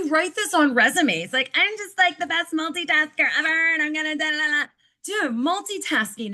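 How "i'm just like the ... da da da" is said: high, airy showing-off voice; hammed-up braggy coworker voice; mock bragging multitasker. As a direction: play the proud multitasker, pitched up.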